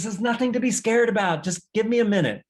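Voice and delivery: high, loud, irritated mother; upset mom. Acted. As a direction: snap back impatiently at the clingy child. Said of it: "she gets upset and she says".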